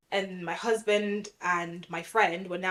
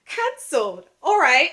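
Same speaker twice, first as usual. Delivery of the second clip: higher and much louder, an exasperated all right; disbelieving; 8 semitones higher, 10 dB louder than usual. Retold or imitated. To the check-in staff after the cancellation, exasperated outburst. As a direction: let the disbelief burst out. Told her flight is cancelled.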